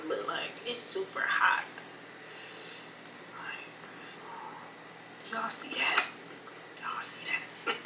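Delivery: soft whisper, close to the mic; hushed, showing the food. Real, not acted. Whispering about the inside of the food.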